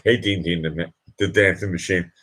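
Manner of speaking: usual speaking voice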